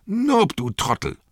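high-pitched, loud scolding shout; exasperated call. Acted. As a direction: yell for a dim servant. Innkeeper yells for his servant.

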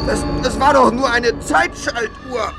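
high, slow, sobbing confession; panicked crying. Acted. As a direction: break down crying in fear. Villain breaks down confessing.

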